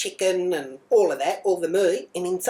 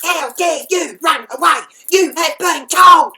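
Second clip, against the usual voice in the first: much higher and far louder, slow; furious yelling; 11 semitones higher, 11 dB louder than usual. Retold or imitated. Mum smacking her daughter with a thong, furious screaming. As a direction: scream the telling-off with every word.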